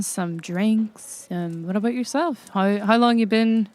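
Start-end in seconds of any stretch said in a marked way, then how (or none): none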